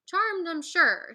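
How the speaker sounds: lightly voiced grand duchess; posh duchess. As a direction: play the haughty duchess. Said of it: "said Duchess Buffet".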